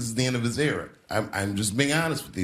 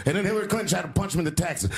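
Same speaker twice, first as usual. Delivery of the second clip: ordinary delivery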